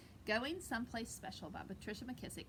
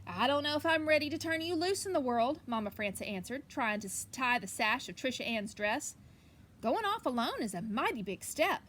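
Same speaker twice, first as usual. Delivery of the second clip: louder read-aloud storytelling voice; lively picture book reading; story reading; 8 dB louder than usual. Acted. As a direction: read it out to the kids with energy.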